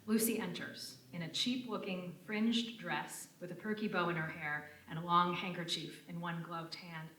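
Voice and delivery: ordinary delivery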